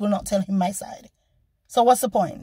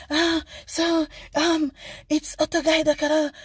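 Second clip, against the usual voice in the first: higher, halting voice imitating an officer; imitated hesitant officer; 4 semitones higher than usual. Police officer excusing himself in Japanese, hesitant imitation. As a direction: mimic a policeman stalling in a raised pitch.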